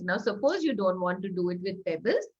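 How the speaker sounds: normal talking voice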